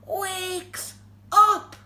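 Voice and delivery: slow, loud, drawn-out build; suspenseful reveal. A narrator's reading. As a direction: stretch each word for the big reveal. Dramatic reveal of waking bear.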